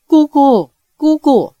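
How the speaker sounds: flustered, urgent repeated call; panicked calling. A narrator's reading. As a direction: call out helplessly in alarm. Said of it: "杨过慌了手脚， 只是叫道".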